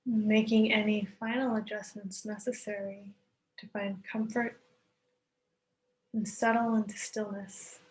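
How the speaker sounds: calm yoga nidra voice; guided meditation. Acted. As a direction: ease listeners into stillness, unhurried. Leads guided relaxation with long pauses.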